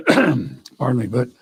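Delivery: hoarse, strained voice with throat clearing; losing voice. Real, not acted. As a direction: speak through a failing, hoarse voice. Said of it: "I'm losing my voice".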